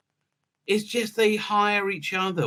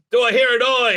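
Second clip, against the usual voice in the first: louder, higher rally call; mock call out; 6 semitones higher, 7 dB louder than usual. Pretend leader calls to the meeting, mock rally shout. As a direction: call to the crowd like a host.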